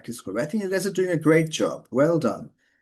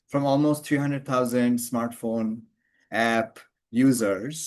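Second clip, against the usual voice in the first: very slow, breaking "smartphone" "app" "users" apart with pauses; "From almost three hundred thousand smartphone app users" chopped up word by word; showing the unnatural way to say it; choppy phrasing; 2 semitones lower, much slower than usual. Shown as a demonstration. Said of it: "ভেঙে ভেঙে বলছেন দেখে it doesn't sound very natural".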